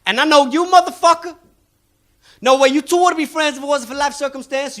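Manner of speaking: high, cocky street con man; high-pitched con man sizing up a mark; mixtape salesman. Acted. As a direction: read them like a hustling salesman. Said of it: "He moved on to the next guy and he goes".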